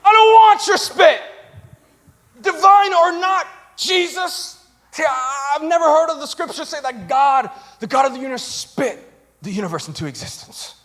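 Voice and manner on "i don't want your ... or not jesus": higher, louder indignant refusal; indignant shout. Retold or imitated. Blind man shouting back at Jesus, indignant.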